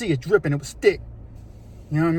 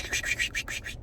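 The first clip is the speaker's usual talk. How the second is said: soft unvoiced squish sounds in the grass; breathy squelching footfalls; squishy steps; 12 dB quieter than usual. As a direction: whisper the squish of wet steps. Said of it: "you can hear a squish".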